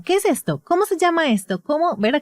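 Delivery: high mock quizzing questions to a child; quizzing parent. Therapist mimics parents quizzing their child, high-pitched. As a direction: play the parent firing questions at a toddler. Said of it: "Es como".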